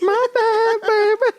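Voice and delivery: very high, put-on voice; mock falsetto apology. Comics joke an apology to the big man, silly falsetto. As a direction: plead playfully way up high.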